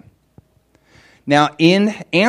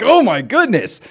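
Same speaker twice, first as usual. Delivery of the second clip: higher, put-on gasp of wonder; mock amazement; 10 semitones higher, 4 dB louder than usual. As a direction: act amazed at the world. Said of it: "It's like".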